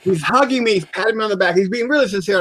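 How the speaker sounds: normal talking voice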